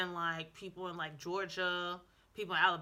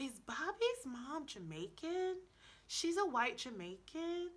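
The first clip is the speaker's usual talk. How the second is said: slow, high-pitched puzzled questioning; mock bewilderment; 7 semitones higher, 4 dB quieter, slower than usual. Wondering about a cartoon mom, in slow mock bewilderment. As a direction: draw it out, acting baffled.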